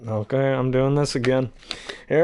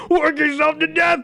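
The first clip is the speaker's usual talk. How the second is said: high incredulous shout. Retold or imitated. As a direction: shriek it back in disbelief. Hades outraged reply.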